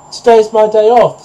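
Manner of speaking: high, loud goose voice; goose refusing. A narrator's reading. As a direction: refuse loudly in a high storybook voice.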